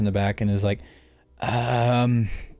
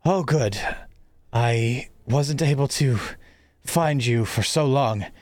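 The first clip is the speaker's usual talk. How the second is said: lightly voiced old headmaster; a hint of an urgent mentor. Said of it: "he says".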